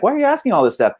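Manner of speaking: baffled question. Retold or imitated. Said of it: "I'm like".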